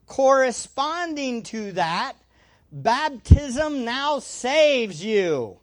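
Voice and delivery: drawn out, each word weighed, leaning on saves; solemn slow scripture reading; slow emphatic reading. Shown as a demonstration. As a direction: read it slowly, letting saves land. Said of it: "So when you read that".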